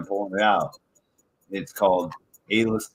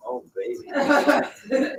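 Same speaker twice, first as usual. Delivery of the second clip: laughing; much slower than usual. Real, not acted. Greeting friend's outfit, laughing.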